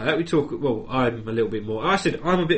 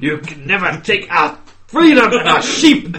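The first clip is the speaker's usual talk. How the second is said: loud mock-heroic Scottish cry; rally the troops in hammed-up Scottish; high, shouted Scottish battle speech, laughing; Scottish Welsh; 5 semitones higher, 9 dB louder than usual. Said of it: "Scottish Welsh accent".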